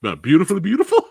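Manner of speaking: laughing at own slip. Real, not acted. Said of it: "Beautifully brutal. That's what I meant to say".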